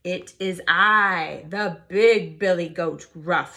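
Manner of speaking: slow, loud, confident goat; loud big goat. Acted. Big goat answering the troll as loud as him, slow. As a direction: stand up to the troll. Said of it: "his voice was as loud as the troll's".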